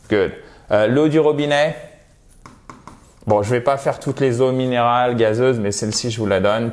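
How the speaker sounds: ordinary talk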